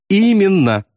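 high, gleeful exclamation; cheerful confirmation. A narrator's reading. Pilot encouraged by his friend, cheerful confirmation. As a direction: affirm it brightly with a smile. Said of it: "с жизнерадостной улыбкой подтвердил Добс".

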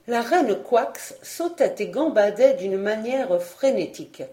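usual speaking voice

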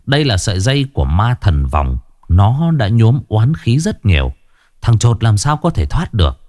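plain reading voice

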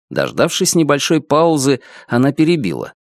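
ordinary delivery, nothing marked

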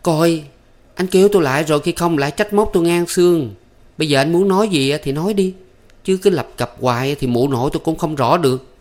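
high-pitched impatient scolding; exasperated. A narrator's reading. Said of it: "Thắm dậm chân chắc lưỡi". (giọng cao, bực bội trách móc)

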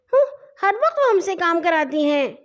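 put-on high, fussy little girl; fussing. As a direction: whine like a child who won't give in. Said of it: "ننھی مچل کر بولی". (مچلتی ہوئی بچکانہ آواز)

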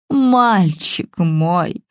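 put-on very slow, sobbing old woman. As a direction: wail it through tears. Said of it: "зарыдала та".

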